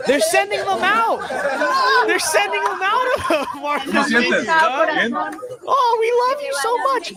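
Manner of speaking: high, loud laughing sarcasm; mocking laughter. Vlogger mocks residents sending migrants away, loud laughing sarcasm. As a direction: crow it out with a laugh.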